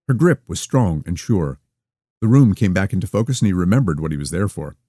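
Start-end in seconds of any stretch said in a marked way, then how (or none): none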